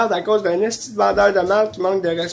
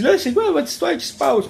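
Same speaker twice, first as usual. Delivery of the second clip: higher, lightly voiced landlady; 8 semitones higher than usual. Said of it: "elle me dit". (propriétaire imitée, voix plus aiguë)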